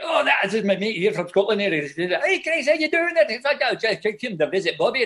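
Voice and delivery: high, garbled Scottish banter; Scottish accent. Retold or imitated. Imitating Scottish friends greeting each other outside the trailers, Scottish accent. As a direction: imitate old buddies in a thick, high-pitched Scottish burr. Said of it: "one of his best friends visit from Scotland".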